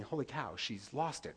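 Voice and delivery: a hushed private gasp of alarm; alarmed inner thought. Retold or imitated. Husband's scared inner thought.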